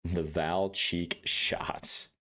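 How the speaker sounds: Val cheek shots drawn out, falling flat; slow deadpan. Real, not acted. Repeating the name slowly.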